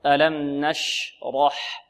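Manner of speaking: very slow ألم نشرح; slow model. Shown as a demonstration. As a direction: stretch ألم نشرح for the class. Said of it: "فرق".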